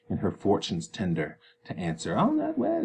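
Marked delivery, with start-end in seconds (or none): 2.2-2.8 very high mock whine of I'll not wed; father mimicking daughter; mocking Juliet. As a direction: squeak it like a sulky girl. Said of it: "he mocks her here".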